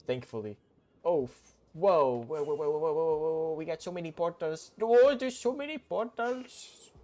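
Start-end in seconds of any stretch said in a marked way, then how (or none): 1.7-4.7 higher, rapid-fire string of whoas; excited outburst. Pretend. Streamer suddenly finding lots of portals, excited outburst. 4.8-6.9 very high, loud, slow mock Indian accent; goofy Indian accent. Streamer being silly over all the portals, high Indian accent. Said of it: "when I'm being silly and goofy, I just do an Indian accent".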